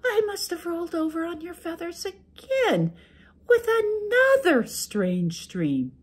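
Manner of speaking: high sweet wife making excuses. Acted. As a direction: play innocent to the ogre, high and gentle. Wife explains.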